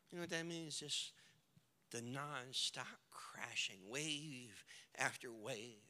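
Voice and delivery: grave, quiet pulpit storytelling; sombre preaching. A narrator's reading. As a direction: let the grief sink in, very softly. Hushed grief narration in sermon.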